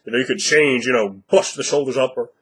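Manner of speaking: ordinary talk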